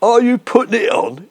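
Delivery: usual speaking voice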